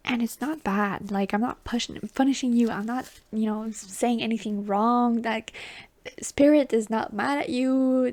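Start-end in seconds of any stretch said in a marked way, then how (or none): none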